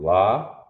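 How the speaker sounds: La said out on its own, clear and drawn out; letter sound. Shown as a demonstration. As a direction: sound out La for the class. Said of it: "say 'em out loud".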